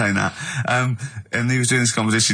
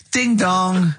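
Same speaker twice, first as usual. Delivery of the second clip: mock doorbell; 6 semitones higher than usual. Acts a doorbell catchphrase.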